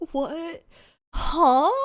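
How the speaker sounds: loud, sharp huh of shock; startled huh. Real, not acted. Streamer hearing the clown song curse, loud startled huh. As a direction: caught off guard.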